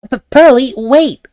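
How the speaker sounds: high, loud call to stop; calling after her. Acted. Maya calls after Pearl as she runs away, high loud call. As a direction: shout after someone running off.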